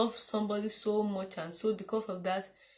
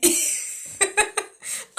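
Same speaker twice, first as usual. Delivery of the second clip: high laugh; 11 semitones higher, 4 dB louder than usual. Real, not acted. Laughing at her own anger.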